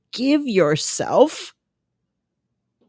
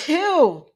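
real high, sudden sneeze; 7 semitones higher than usual. Real, not acted. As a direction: let a sneeze burst out. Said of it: "I have another sneeze coming".

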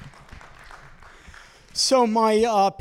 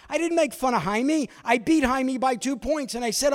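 normal talking voice

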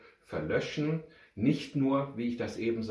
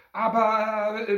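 high, loud, drawn-out but; protesting boy cut short; begun protest; 10 semitones higher, 8 dB louder, slower than usual. A narrator's reading. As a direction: start to object, pitched up. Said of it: "begann Nick".

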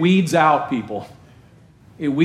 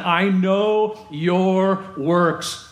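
slow solemn quote; much slower than usual. Acted. Quoting Jesus slowly to the church.

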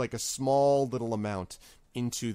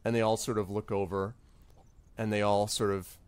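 ordinary delivery

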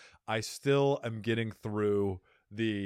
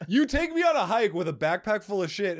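mock outrage; 10 semitones higher, 7 dB louder than usual. Mock accusation to friend.

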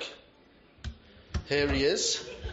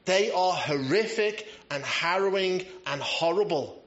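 ordinary talk, nothing marked

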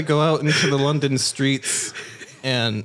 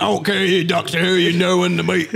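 higher, mock Cockney banter; London driver; 5 semitones higher, 3 dB louder than usual. Driver mistaking him for Doctor Who, mock Cockney. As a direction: toss it off in a broad fake London accent.

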